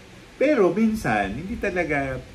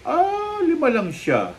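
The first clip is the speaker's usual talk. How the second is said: mocking quote; 14 semitones higher, 4 dB louder, slower than usual. Mocking woman's dismissive reply.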